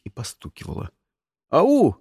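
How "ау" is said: short higher-pitched call. A narrator's reading. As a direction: call out to see who answers. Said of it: "крикнул я". (громкий оклик)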